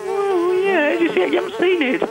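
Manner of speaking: usual speaking voice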